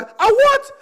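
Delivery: high, puzzled "A what?"; mock the baffled cashier; confused cashier. Cashier who can't understand him, squeaking in confusion.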